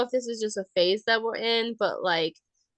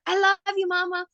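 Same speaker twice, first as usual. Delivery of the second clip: higher, louder little-kid imitation; toddler getting louder; 8 semitones higher, 4 dB louder than usual. Toddler calls after mom leaving his room, louder child voice. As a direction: raise the voice like an insistent toddler. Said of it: "he just kept saying it louder and louder".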